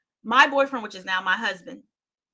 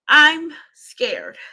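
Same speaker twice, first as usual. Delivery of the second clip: louder, higher cry of fear; scared outburst; 5 semitones higher, 8 dB louder than usual. Real, not acted. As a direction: blurt out the fear, loud.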